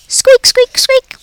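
high squeaky squeak, squeak, squeak; three quick high-pitched rodent squeaks; hamster squeaks. Acted. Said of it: "all that came out was".

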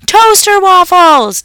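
high, loud and slow. A narrator's reading. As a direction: bellow the answer. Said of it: "Dad yelled louder".

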